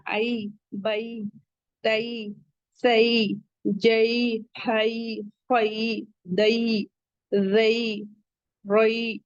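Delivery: ائی، بئی، تئی with a soft ai glide, not ay; soft ya leen. Shown as a demonstration. Teacher modelling correct ya leen pronunciation. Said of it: "معروف طریقے سے پڑھنا ہے".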